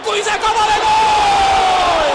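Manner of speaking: long, high, loud drawn-out goal; screaming goal call. Real, not acted. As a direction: stretch the goal scream out slowly. Goal called out. (uzatılmış gol çığlığı)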